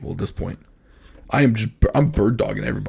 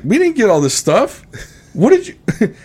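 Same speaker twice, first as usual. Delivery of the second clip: higher, puzzled wife voice; a wife demanding answers from her husband; mock confused wife; 9 semitones higher than usual. Wife asking husband why the cart is full, higher and puzzled. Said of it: "she's like".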